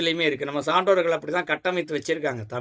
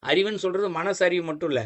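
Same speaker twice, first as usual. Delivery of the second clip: normal talking voice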